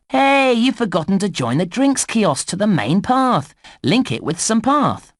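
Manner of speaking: high-pitched nudge to the player; calling out a mistake. Acted. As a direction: call for attention, pitched high. Game voice calls out player's mistake.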